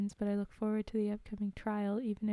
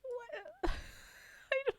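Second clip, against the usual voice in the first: squeaky surprise; slower than usual. Real, not acted. Flustered at Luigi request.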